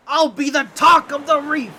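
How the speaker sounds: excited boast. Acted. Octopus gloating about fame.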